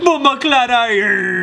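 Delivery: high, slow laughing patois; hammed-up Jamaican curse; mock Jamaican. Friends joking in a loud mock Jamaican voice.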